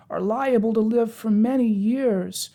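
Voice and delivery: high, slow, mournful Pumpkinhead; gloomy. A narrator's reading. Pumpkinhead bemoaning his short life, gloomy. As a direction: lament slowly in a high-pitched voice. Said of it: "said the Pumpkinhead gloomily".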